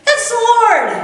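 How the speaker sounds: high and loud, a sudden cry of recognition; excited announcement. Retold or imitated. John spots Jesus and tells the disciples, high excited cry. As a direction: blurt out the discovery. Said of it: "one disciple said".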